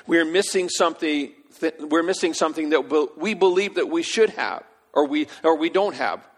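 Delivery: loud, high preaching push; preacher rising in intensity; fervent preaching. Acted. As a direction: drive it home to the congregation. Preaching at full force.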